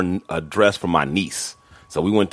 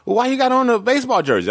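much higher, pressing voice; a wife calling him out; wife questioning his jersey, mock pressing; challenging wife; faster than usual.

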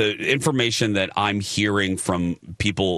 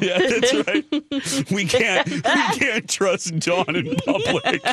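laughing; slower than usual. Real, not acted. Laughing teasing co-host.

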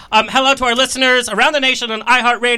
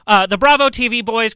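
ordinary delivery

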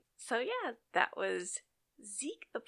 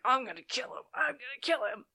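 higher-pitched imitated fury; hammed-up mad outburst; mock rage; 7 semitones higher than usual. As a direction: rant it like a wronged kid vowing revenge. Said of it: "he gets so mad".

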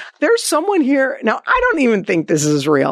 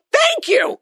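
emphatic thanks. Real, not acted. Host exclaims at guest's advice.

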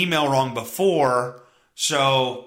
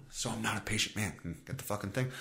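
muttered aside; 6 semitones lower, 14 dB quieter, faster than usual. Real, not acted. Muttered aside under his breath.

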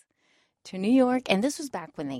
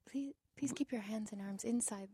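whispered cabin announcement; hushed airline safety notice; flight attendant whisper; 4 semitones higher, 11 dB quieter than usual. Pretend. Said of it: "I could do that really well right now".

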